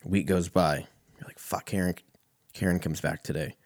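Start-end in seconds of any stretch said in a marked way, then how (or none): 1.4-3.6 muttered dread. Retold or imitated. Dreading Karen's return.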